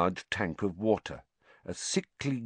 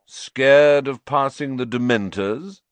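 loud, slow, higher-pitched taunt; bully's drawn-out jeer; jeering shout; 6 semitones higher, 9 dB louder, slower than usual. A narrator's reading. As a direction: draw out the mockery loudly. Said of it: "shouted Malfoy".

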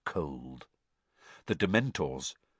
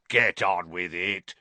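sneered. A narrator's reading. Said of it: "sneered Moody".

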